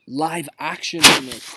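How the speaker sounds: dramatic discovery, drawn out; gasping realization. Acted. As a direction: gasp then slow announcement.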